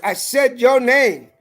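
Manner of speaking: every word slow and weighty, I said your name; slow and emphatic. Real, not acted. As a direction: spell it out slowly to the judge.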